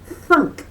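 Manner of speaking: high loud cartoon thunk; thunk sound. A narrator's reading. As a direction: bonk out the headbutt noise. Fight sound effect.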